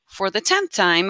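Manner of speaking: ordinary talk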